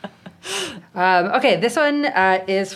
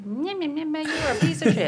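higher-pitched mock nagging woman; woman impression; 7 semitones higher than usual. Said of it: "Is that your woman impression?".

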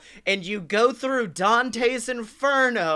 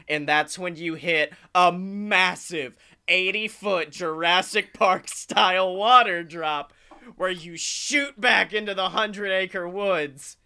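ordinary talk, nothing marked

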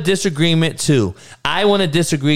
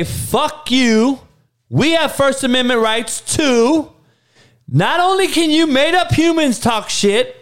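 defiant outburst; 8 semitones higher than usual. Retold or imitated. Voicing people fighting back against the made-up humans, high and angry. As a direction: yell it back at them like you've had enough. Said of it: "fight back with your fucking voice and say".

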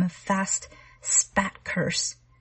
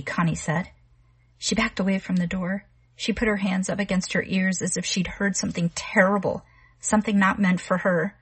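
ordinary delivery, nothing marked

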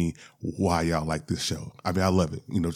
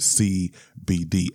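C B D spelled out slowly, letter by letter; 3 semitones lower, slower than usual. Shown as a demonstration. Host says C B D slowly so he won't mess it up, slow and careful. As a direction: take C B D slow and careful so you don't trip. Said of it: "I have to say it slowly when I'm saying it".

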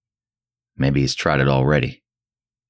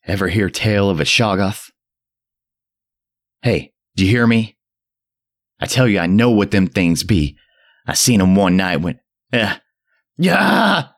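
put-on mad frenzied old man; 5 semitones higher than usual. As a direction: rave at the boy in frenzy. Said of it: "the mad frenzy of his voice".

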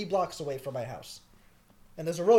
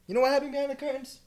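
teasing singsong; 8 semitones higher, faster than usual. Real, not acted. Teasing repeated question.

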